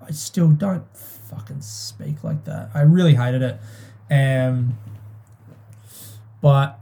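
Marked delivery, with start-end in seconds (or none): 0.1-2.7 soft, slow whisper of disgust. Real, not acted. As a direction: hiss it under your breath, slowly. Disgusted aside about dad's words.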